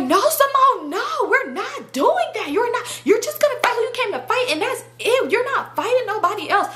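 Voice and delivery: high, insistent mimicked objection; hammed-up high pleading refusal; acted friend's protest. Friend tells Simone she can't fight, high protest.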